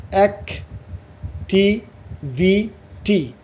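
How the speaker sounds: "Activity" very slowly as অ্যাক, টি, ভি, টি; four separate syllables. Shown as a demonstration. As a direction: break "activity" into four beats with long gaps. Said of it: "চারটা syllable". ("Activity" চার সিলেবলে থেমে থেমে)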